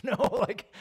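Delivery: high, loud "no" with a laugh; comic high-pitched outburst; laughing denial. Acted. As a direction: burst out protesting through laughter. Acted denial to alarmed dinner guest.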